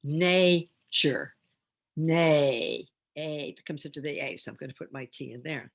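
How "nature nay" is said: NATURE split into "nay" and "chur"; NAY drawn out as its own syllable. Shown as a demonstration. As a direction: stretch "Nature" in two separate beats. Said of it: "have to do the syllable division".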